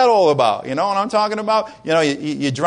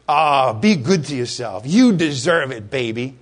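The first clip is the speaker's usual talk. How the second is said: lower, slow, coaxing commercial purr; mock sales seduction, drawn out; smooth ad voice; 6 semitones lower than usual. Preacher mimicking a TV ad tempting the viewer, slow and coaxing.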